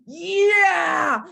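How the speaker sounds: loud, pumped-up yell; retold victory whoop; triumphant shout. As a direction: shout it like you just won. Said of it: "until I went".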